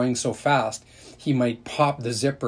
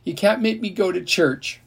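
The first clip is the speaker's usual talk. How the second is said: wailing whine; 8 semitones higher than usual. A narrator's reading. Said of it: "A wail went up from Ellie and Brenda like two sirens".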